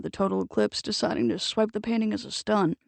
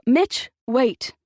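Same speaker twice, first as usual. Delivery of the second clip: louder call to stop him; calling out across the lot; 4 semitones higher, 6 dB louder than usual. A narrator's reading. Octavia calling across the lot to Mitch, loud. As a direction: call out to halt the old man. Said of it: "Octavia's clear voice echoed across".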